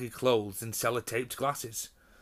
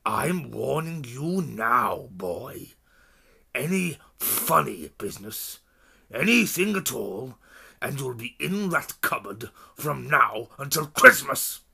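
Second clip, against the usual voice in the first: slow, menacing warning; a heavy uncle leaning in with a threat; slow stern threat; threatening uncle; 5 dB louder than usual. Acted. Uncle threatening Harry up close.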